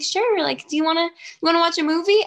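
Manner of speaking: high, sugary and eager; high, bright mock of an overly willing girl; mock eager girlfriend. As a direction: play the too-available girl, mocking. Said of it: "And, like".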